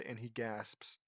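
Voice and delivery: usual speaking voice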